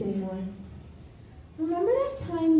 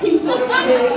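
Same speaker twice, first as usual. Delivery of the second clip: laughing; 12 semitones higher, 6 dB louder than usual. Acted. Laughing at friend's admission.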